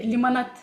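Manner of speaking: "lemonade" pronounced limonad, ending in -ad; Russian pronunciation. Shown as a demonstration. Teacher showing how Russians say "lemonade", as limonad. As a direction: say "lemonade" the Russian way, with -nad for -nade. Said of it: "In Russian, we say lemonade".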